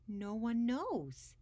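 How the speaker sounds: plain reading voice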